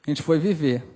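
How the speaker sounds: usual speaking voice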